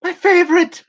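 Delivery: high, loud, laughing delight. Real, not acted. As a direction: squeal with laughing joy. Delighted about cookies.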